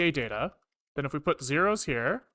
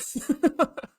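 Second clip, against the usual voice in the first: short, high laugh of disbelief; exasperated laugh; 10 semitones higher than usual. Real, not acted. Coder laughing at code that ignored him, high disbelieving laugh. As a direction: laugh at how absurd the bug is. Laughs after failed test.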